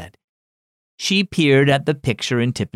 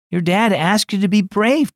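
higher, a hint of a stern mother; firm mom; 6 semitones higher than usual. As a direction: mildly scold the child. Mom urges bravery.

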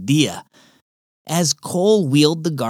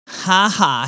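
sarcastic; 7 semitones higher than usual. Acted. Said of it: "said Cole sarcastically".

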